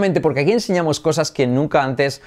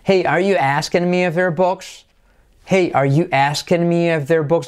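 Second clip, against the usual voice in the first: "Hey, are you asking me if they're books?" slow and high; high questioning model of "Hey, are you asking me if they're books?"; question intonation; 5 semitones higher, slower than usual. Shown as a demonstration. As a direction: lift "Hey" and carry the query up to "books". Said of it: "entonación sería, como es pregunta".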